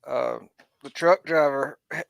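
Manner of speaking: normal talking voice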